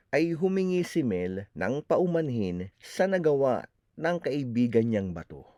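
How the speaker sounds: ordinary delivery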